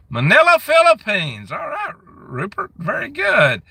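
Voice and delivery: high, loud delighted welcome; light up at the name Manila; excited greeting. Real, not acted. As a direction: streamer thrilled to see a Philippines viewer. Thrilled greeting Philippine viewers.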